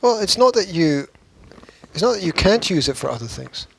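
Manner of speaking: normal talking voice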